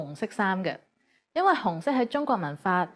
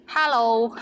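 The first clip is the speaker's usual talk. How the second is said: hello as "haa-lo", Cantonese-style; Cantonese accent; 4 dB louder than usual. Shown as a demonstration. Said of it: "with the Cantonese accent".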